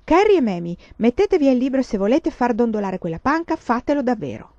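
teacher scolding the class; curt rebuke. Acted. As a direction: cut the girls off sharply. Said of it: "disse seccamente".

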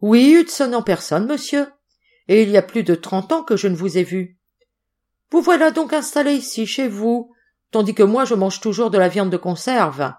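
a hint of a mocking seaman, needling his old master; sly sailor, lightly played; sailor Hudson. A narrator's reading.

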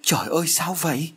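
high, mild mock scream of fright. Mother and daughter see the noose move, mild frightened scream. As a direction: shriek at the moving noose, understated. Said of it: "cùng kêu thét lên".

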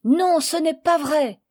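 high, loud protest; a boy shouting no, that's not true; indignant cry. Acted. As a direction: cry out in hot denial. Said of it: "s'écria Jacques".

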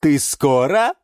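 high, slow, emphatic pronouncement; ceremonious, stretched announcement; solemn verdict. Acted. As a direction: deliver the diagnosis with drawn-out weight.

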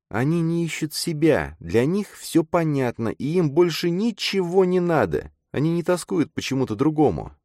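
put-on high-pitched girl's voice; disillusioned girl character. A narrator's reading. Girl tells her friend what sets them apart, weary of it.

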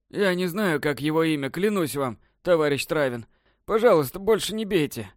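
put-on high, pleading, sobbing old man; breathless old person. A narrator's reading. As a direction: beg for mercy, out of breath. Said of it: "Старик тяжело дышал, жалуясь на боли". (задыхающийся старик, умоляет)

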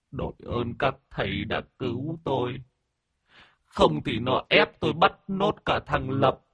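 high-pitched weepy gratitude; sniffling, tearful. Acted. Old master thanks the exorcists for saving him, sniffling. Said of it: "Ông chủ sụt sùi".

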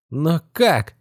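put-on high, bewildered young boy; boy baffled by the scene; puzzled boy. A narrator's reading.

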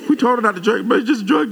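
high-pitched imitation of a pleading church lady; woman's voice. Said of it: "I don't know I'm talking like this".

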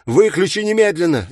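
loud, high-pitched furious order; angry demand. A narrator's reading. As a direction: demand it with urgent spite. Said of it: "злобно прорычал Такахаси".

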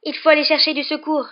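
put-on high, loud, urgent girl's cry; urgent exclamation. A narrator's reading. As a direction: exclaim it, pleading for help. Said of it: "s'exclama Hermione".

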